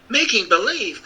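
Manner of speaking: put-on high, startled girl's cry; Dorothy cries out in shock; surprised cry. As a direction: blurt it out in disbelief. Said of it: "cried Dorothy".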